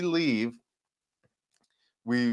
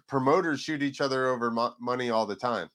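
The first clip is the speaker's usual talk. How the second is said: ordinary talk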